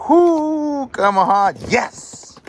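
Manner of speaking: high, loud whoop of delight; excited whoop. Real, not acted. Collector opening a box of cars, cheering loudly. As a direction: cheer the find like a win.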